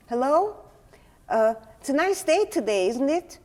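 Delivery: high, loud, sing-song neighborly hello; mother's balcony greeting. Retold or imitated. Mother on the balcony hailing the neighbor, high and loud.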